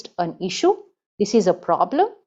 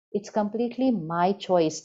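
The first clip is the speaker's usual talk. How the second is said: usual speaking voice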